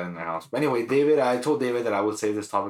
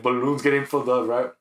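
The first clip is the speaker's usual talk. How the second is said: ordinary talk, nothing marked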